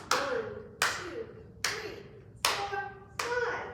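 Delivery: counting out loud. Acted. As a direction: count the strikes out for kids. Counting clock strikes aloud.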